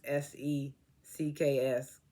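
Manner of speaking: slow letter-by-letter "S E C K S"; spelled out letters. Shown as a demonstration. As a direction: spell "S E C K S" slowly, one letter at a time. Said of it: "and I wrote sex".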